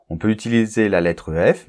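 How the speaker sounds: normal talking voice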